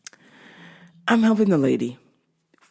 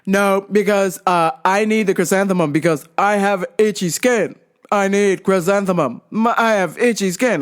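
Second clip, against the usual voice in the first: insistent mock deaf man's voice; yelling deaf customer. Deaf customer explains itchy skin to worker, imitated yelling. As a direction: imitate a deaf customer over-projecting each phrase. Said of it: "he's yelling back at me".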